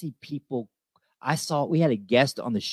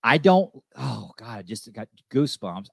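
ordinary talk, nothing marked

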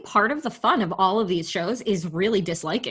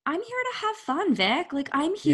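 much higher, playful protest; mock plea; 7 semitones higher than usual. Speaking to Vicki: I want fun, playful high protest. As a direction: squeal it like a fed-up party guest.

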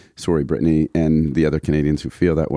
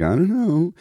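shrugging; 10 semitones higher than usual. Retold or imitated. Voicing shrug emoji.

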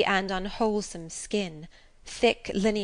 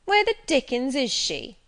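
put-on bullying boy; 6 semitones higher, 6 dB louder than usual. A narrator's reading. Bully boy hunting for Jane.

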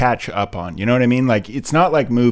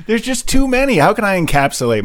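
exasperated whine; 10 semitones higher, 3 dB louder than usual. Real, not acted.

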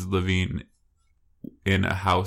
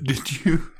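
laughing through the question; laughing hard; 6 semitones higher than usual. Real, not acted. Co-host teasing friend about crying in class, laughing. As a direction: barely hold back real laughter as you ask. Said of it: "I'm sorry about laughing so hard".

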